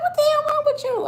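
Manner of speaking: very high, loud shriek; mock horrified mother. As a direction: screech it like an appalled mama. Mother reacts to the blistered mouth.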